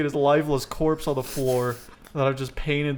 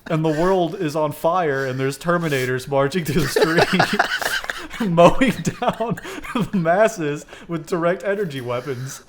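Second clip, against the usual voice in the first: loud laughing narration; 2 semitones higher, 6 dB louder than usual. Real, not acted. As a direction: tell it while cracking up. Laughing through absurd story.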